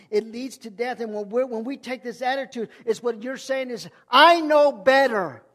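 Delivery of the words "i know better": loud, slow mock boast; proud boast. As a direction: shout it out like a proud know-it-all. Mocking proud self-reliant believer.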